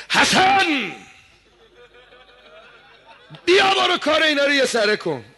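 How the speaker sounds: high, loud battlefield call to his son; a commander's loud high call to Hasan. Acted. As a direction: summon him across the field, ringing out. Ali calls Hasan to battle.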